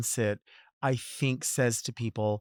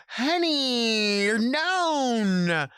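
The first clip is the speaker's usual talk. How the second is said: high, drawn-out exclamation; hammed-up high-pitched cheering on; mock hype; 10 semitones higher, 4 dB louder, much slower than usual. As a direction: stretch it out in over-the-top acclaim. Acted mock hyping of someone known.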